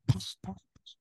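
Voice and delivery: unvoiced whisper through the door; whispering through the front door at dawn; whispered call. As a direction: hiss softly at the door. Door voice calling quietly.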